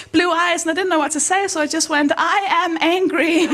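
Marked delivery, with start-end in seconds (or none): 2.2-3.5 higher, awkwardly emphatic declaration; mock-angry blurt. Blurting the sentence to her punched friend, mock angry. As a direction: blurt the rehearsed sentence with forced feeling.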